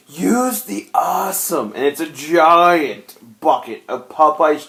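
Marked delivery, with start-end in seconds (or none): 0.1-1.7 slow, higher drawn-out line; slow movie quote. Retold or imitated. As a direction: draw it out grandly. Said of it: "And then he goes".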